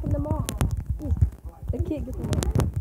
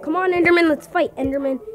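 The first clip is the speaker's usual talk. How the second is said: loud, raised-pitch dare; shouted challenge; 4 semitones higher, 7 dB louder than usual. Real, not acted. Player daring Enderman to fight, challenging. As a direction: call the enemy out loudly.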